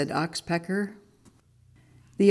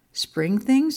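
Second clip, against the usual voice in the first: high, rising storybook query; puzzled question; 7 semitones higher, 4 dB louder than usual. A narrator's reading. Monkey hears his friend's odd advice, puzzled. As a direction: echo it with puzzled surprise.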